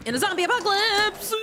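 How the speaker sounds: high excited exclamation. Acted.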